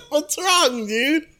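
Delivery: high-pitched, squeezed out through laughter; helpless laughter in the words; real laughing fit. Real, not acted. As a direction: crack up while trying to talk. Cracks up mid-story.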